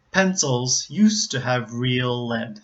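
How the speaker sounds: slow, careful model reading; practice sentence. Shown as a demonstration. Teacher gives a sentence to practice, slow model reading. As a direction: read it slowly for learners to copy. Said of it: "sentences that perhaps you could practice".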